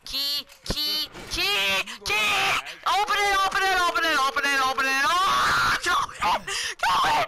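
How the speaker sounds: frantic, high, loud repeated cries; panicked shrieking, desperate to escape; screaming. Real, not acted. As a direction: build from urgent chanting to a full scream. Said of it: "sorry for screaming there".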